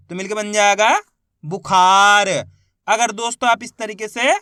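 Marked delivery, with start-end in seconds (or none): 1.4-2.3 "बुखार" read out whole with the middle vowel stretched; अक्षर मिलाकर उच्चारण. Shown as a demonstration. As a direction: blend "बुखार" into one word, long loud "खा". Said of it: "इनको आपस में लाके पढ़ना है". ("बुखार" को जोड़कर, "खा" खींचकर ज़ोर से बोलना)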